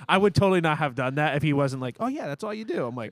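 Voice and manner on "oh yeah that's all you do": a much higher, casual mock pastor; breezy pastor tip. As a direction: say it like it's nothing. Mimics pastor's easy cooking tip.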